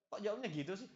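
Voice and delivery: acted offended questioning; mock indignant wife. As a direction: play a wife put out by a text reply. Acted spouses quarrel over text message.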